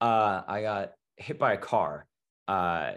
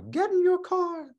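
very high, slow, eerie little voice; a small ghostly voice inside his head; tiny inner voice; 4 dB louder than usual. Acted. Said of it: "little voice in the back of his head".

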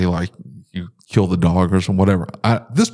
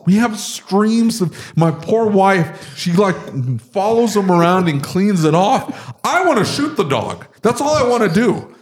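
fired up; 9 semitones higher than usual. Real, not acted. Man complaining about his ruined house, fired up. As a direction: keep ranting, pitch up high. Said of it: "Got him fired up here".

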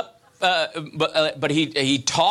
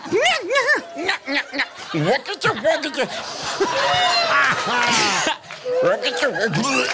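strangled, gargling yowl like a sick cat; cat gagging before it barfs; cat about to throw up; 3 semitones higher than usual. Shown as a demonstration. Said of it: "an impersonation of Charlie the cat right before he throws up".

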